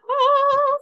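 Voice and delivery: high, loud fangirl squeal; inner fangirl. Acted. Co-host voicing her friend's inner fangirl, excited squeal. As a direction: squeal it like a starstruck fan. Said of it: "Your inner fangirl's like".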